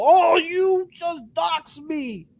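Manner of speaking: high, slow mocking shout; mock outraged whine. Mocking G-Man crying about being doxed, slow high mock shout. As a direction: whine it out like a hurt accuser.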